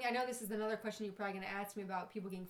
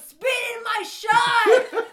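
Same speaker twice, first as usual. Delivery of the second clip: slow, much higher and louder laughing shout; rowdy shout; 11 semitones higher, 16 dB louder, much slower than usual. Retold or imitated. Drunk guy at the bar yelling his dare, loud and slow. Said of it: "the guy goes".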